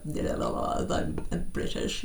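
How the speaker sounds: mock-posh proper British accent. North American parodying how the British sound, proper British accent. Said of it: "we hear a very, you know, proper".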